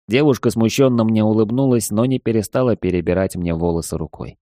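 ordinary delivery

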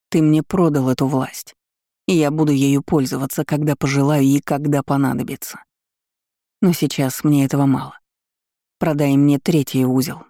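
usual speaking voice

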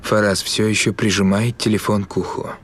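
ordinary delivery

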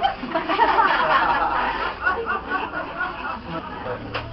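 high, soft laughing; laughter. Real, not acted. The preacher laughing softly at his own joke.

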